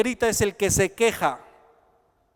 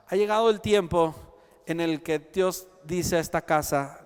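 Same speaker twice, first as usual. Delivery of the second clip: ordinary talk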